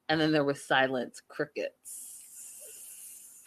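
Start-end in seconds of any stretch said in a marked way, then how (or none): 1.3-3.4 whispered, slow crickets. Pretend. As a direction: breathe it like empty silence. Silence joke.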